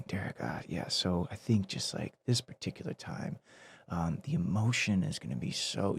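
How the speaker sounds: whispering softly, tiptoeing around it; a hushed, careful director nudging his actor; hushed gentle hint. Retold or imitated.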